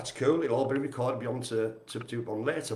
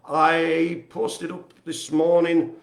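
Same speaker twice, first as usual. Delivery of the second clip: loud, high, stretched-out presenter delivery; showy announcement; 4 semitones higher, 7 dB louder, much slower than usual. Pretend. Host presenting this morning's artwork post, loud drawn-out announcement. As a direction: hold the words out like an announcer.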